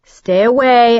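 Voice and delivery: loud, high warning; frightened loud demand. A narrator's reading. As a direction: order him back, loud. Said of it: "I demanded".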